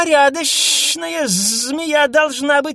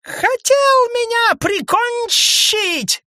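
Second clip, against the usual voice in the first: very high slow shrieking; near shriek; 5 dB louder, much slower than usual. A narrator's reading. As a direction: nearly squeal it. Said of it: "Почти завизжала крулянка". (почти визг)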